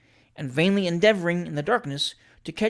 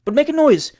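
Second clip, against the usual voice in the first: higher, louder urging; urging aloud; 10 semitones higher, 8 dB louder than usual. Acted. Mayor urging Trott to make noise, louder urging. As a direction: push him to keep up the act.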